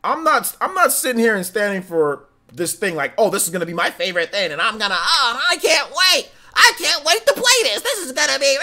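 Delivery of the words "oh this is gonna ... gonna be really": high, fast, hammed-up excitement; squealing mock enthusiasm about a new game; mock gushing fan. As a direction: gush like an overeager fanboy. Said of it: "I'm not sitting here and saying that".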